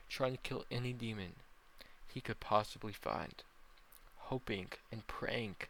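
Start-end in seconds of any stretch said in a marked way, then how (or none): none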